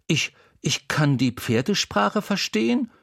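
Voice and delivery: put-on a boy stammering in wonder; young Wendel. A narrator's reading.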